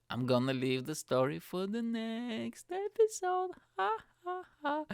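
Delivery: teasing cliffhanger. Pretend.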